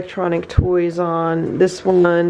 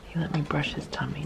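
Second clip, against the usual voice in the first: very soft murmur, much softer; a near-silent voiced murmur; barely voiced murmur; 3 semitones lower, 13 dB quieter than usual. Real, not acted. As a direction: murmur it almost to yourself. Repeated even softer.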